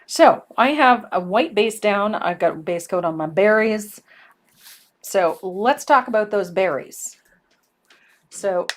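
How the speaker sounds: loud, right into the mic; booming and clipping the microphone; too close to mic. Real, not acted. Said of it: "you're spiking the microphone".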